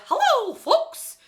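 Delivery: very high, loud and slow cartoon mouse hello; animated falsetto mouse voice; Mickey Mouse voice. Shown as a demonstration. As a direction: stretch out a squeaky Disney greeting. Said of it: "just has an animation".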